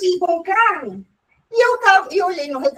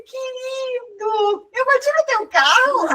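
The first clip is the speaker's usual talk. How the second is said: sweet sheepish question; 9 semitones higher, slower than usual. Retold or imitated. Sweetly asking the driver she hit.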